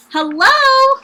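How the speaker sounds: high, loud sung-out hello; calling hello. Acted. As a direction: call up to the passing elephant. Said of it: "called the lazy bird".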